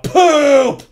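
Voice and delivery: high, loud shout of a silly word; yelling. Retold or imitated. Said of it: "a guy just yelling out".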